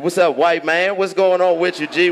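ordinary talk, nothing marked

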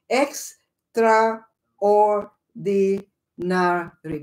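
"Extraordinary" drawn out very slowly, each syllable pulled apart. Shown as a demonstration. Host slowly counting out syllables for stream viewers, very slow. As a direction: stretch "Extraordinary" out so every one of its six syllables can be counted. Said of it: "That's six syllables".

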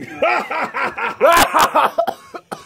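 long rising evil laugh. Acted. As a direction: cackle like a villain.

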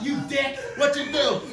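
put-on high scolding mom voice, Italian accent; high-pitched scolding Italian mom; little Italian mom. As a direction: mimic his tiny Italian mother snapping. Mom yelling at him.

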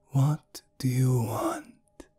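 unvoiced, slow whisper. A narrator's reading. Servant answering her summoner, whispered. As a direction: breathe it out slowly. She appears, whispered lines.

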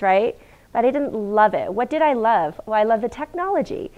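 ordinary delivery

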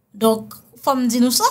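ordinary talk, nothing marked

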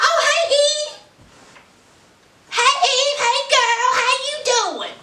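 put-on high, sing-song girly hello; put-on sweet, high-pitched chirp; sugary rival greeting. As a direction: imitate an overfriendly other woman. Voicing the harlot Gemma's fake-friendly greeting.

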